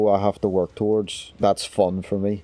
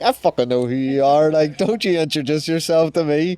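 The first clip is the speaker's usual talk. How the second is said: higher, incredulous fan outburst; pitched-up, mock-indignant awe; retold star-struck protest; 7 semitones higher than usual. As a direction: protest in starry-eyed disbelief.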